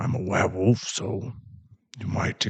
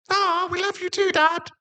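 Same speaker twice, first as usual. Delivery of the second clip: very high, eager puppy voice; excited dog boy. Acted. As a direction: chirp it sweetly like a puppy. Dog son answers dad.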